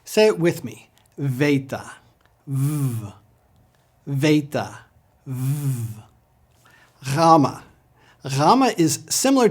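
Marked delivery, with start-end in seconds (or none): 1.2-6.0 "VAY-ta", then a long buzzing "vvv"; "Theta" as "VAY-ta", "V" as a held vvv; Greek beta with V. Shown as a demonstration. Teacher leads learners in repeating, V-sound beta. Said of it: "pronounced like our V instead of B". 7.1-7.6 "Gamma" with a soft gargled gh, "GHAH-ma"; soft Greek gamma. Shown as a demonstration. Teacher models the Greek letter, soft gargled G. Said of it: "but with a softer sound".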